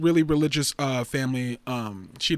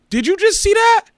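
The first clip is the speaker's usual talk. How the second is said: much higher, loud, startled outburst; real indignant surprise; real shock; 12 semitones higher, 8 dB louder than usual. Real, not acted. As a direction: yelp at the page suddenly refreshing. Page refreshes mid-stream.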